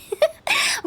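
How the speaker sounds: short, high-pitched giggle; amused laugh. Acted. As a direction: burst into a brief high laugh. Amused at being taken for healer.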